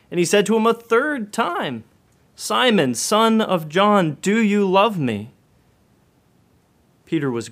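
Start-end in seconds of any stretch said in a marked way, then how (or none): 0.1-5.3 raised, slower, pleading question; pressing third time. Acted. Preacher voicing Jesus pressing Peter a third time, loud and slow. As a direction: lean hard into the third asking.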